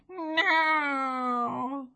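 a high, thin cat-like cry on the word; boy answering with a mewing no; high little mew. Acted. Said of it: "said Tom".

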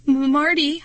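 high, frightened stammer; nervous, halting call to a friend. A narrator's reading. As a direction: trip on the first sound in fear. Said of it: "I stammered".

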